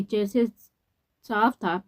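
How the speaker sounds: usual speaking voice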